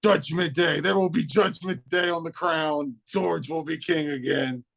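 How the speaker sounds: a low, looming alien threat; Spaceman George voice. Acted. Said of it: "It is I, Spaceman George".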